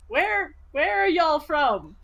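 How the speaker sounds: stiff, slow attempt at friendliness; awkward small talk. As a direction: awkwardly address children, drawing it out. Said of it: "stands awkwardly around the children".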